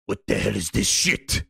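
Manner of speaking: very low mock-menacing growl, rising at the end; Herman impression. Herman coming in here, very low disgusted voice. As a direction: play the boss appalled under his breath, way down low. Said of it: "he would come in here and go".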